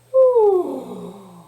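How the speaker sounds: loud, high "ooh" sigh sliding down; playful, exaggerated sigh; fun sighing sound. Shown as a demonstration. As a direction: breathe out with a hooting swoop. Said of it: "practice doing that with a fun sound".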